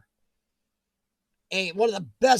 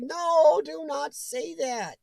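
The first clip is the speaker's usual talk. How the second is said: slow warning; 7 semitones higher, much slower than usual. Retold or imitated. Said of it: "I was like".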